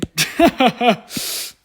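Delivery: high, loud burst of laughter. Real, not acted. Laughs at own joke.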